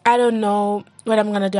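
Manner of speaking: ordinary talk, nothing marked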